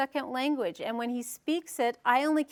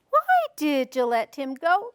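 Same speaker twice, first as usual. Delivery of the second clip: measured question with "go" rising at the end; questioning tune; 3 semitones higher, 4 dB louder than usual. Shown as a demonstration. Teacher reciting rhyme to parents, "go" rising as question. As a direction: lift "Why" and rise on "go". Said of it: "Was there a question?".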